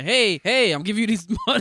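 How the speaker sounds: mock calling out. Acting out filmmakers approaching a homeless guy, mock hailing. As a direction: hail someone loudly, half laughing.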